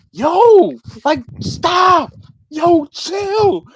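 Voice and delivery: loud, high mock yell; exasperated outburst. Telling the coworker to back off, loud high exasperation. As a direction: bark it high, in short bursts. Acted quarrel, telling her stop.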